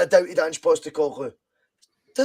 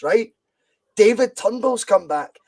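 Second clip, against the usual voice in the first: ordinary delivery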